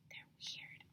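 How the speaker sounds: whispered secret. A narrator's reading. Narrator whispering to boys and girls. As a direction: breathe it to the kids. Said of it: "whispered aside".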